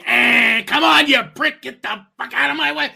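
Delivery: loud, high, impatient road-rage yell; rude New Yorker. Acted. New York driver the second the light turns green, rude yelling. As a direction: holler it at the car in front, acted up. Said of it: "people think New Yorkers are rude".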